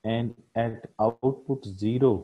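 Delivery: slow, word by word dictation so students can write; teacher spells out the line for notes, very slowly; dictating slowly. Shown as a demonstration. Teacher dictating to the class, slow and spaced. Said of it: "तो आप लिख सकते हैं".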